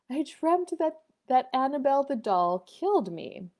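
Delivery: put-on young daughter. Daughter telling mother her bad dream, childlike. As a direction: voice a little girl recounting her nightmare. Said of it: "And she said".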